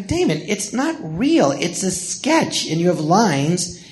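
high, slow, imploring reasoning; exasperated pleading. Retold or imitated. Begging the upset castmate to see it's only a sketch, high and slow. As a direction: coax him like a frustrated friend.

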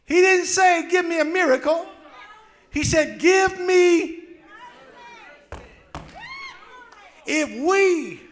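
high loud fervent preaching; preaching at full force. Acted. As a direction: drive it home to the congregation.

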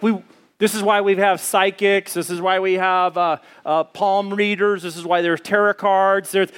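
ordinary talk, nothing marked

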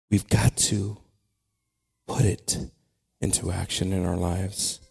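low, soft, halting with long pauses; hushed prayer. Real, not acted. Preacher calling on the Holy Spirit to calm the storm, hushed prayer. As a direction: pray quietly and reverently, phrase by phrase.

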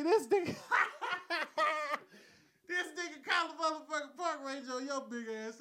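words broken by laughter; laughing while talking. Real, not acted. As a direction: talk through laughter. Telling her through laughter.

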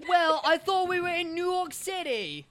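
high, slow mock New Yorker; New York accent. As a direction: drag it out as a puzzled New Yorker. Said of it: "a New Yorker".

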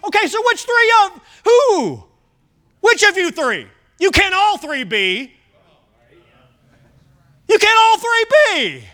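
high, loud incredulous outburst; exasperated challenge. Acted. Preacher confronting three men who each claim to be Jesus, exasperated. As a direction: build to a high, loud cry of disbelief.

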